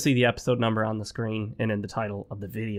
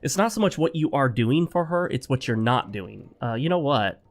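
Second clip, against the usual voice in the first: normal talking voice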